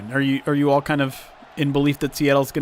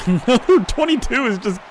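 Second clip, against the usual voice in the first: amused laughing; 5 semitones higher than usual. Real, not acted. Laughs at player getting beaten.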